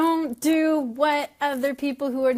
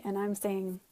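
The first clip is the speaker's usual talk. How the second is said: usual speaking voice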